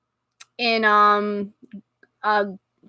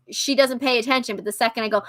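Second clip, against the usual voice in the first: ordinary talk, nothing marked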